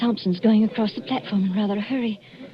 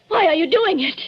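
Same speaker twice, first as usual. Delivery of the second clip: higher and louder, pleading; desperate plea; 8 semitones higher, 6 dB louder than usual. Acted. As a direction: cry out the question in despair. Wife pleads with poisoner husband.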